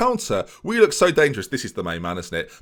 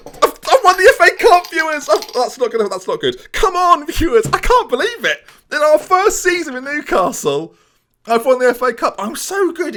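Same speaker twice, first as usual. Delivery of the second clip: much higher, louder disbelieving cheer; ecstatic shouting; 12 semitones higher, 6 dB louder than usual. Real, not acted. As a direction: scream with joy at the final whistle. Winning the cup, celebrating.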